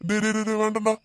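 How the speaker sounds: high-pitched, repeated frantic plea; panicked begging. Acted. Kidnapper begging rescuer to stop, panicked. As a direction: beg the attacker not to hit. Kidnapper begs during beating.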